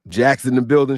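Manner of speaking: ordinary talk, nothing marked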